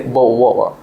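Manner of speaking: London accent, T dropped from "water". Shown as a demonstration. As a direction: say "Boat water" London-style, swallowing the Ts. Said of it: "in London accent, they will say".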